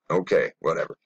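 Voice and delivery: ordinary talk, nothing marked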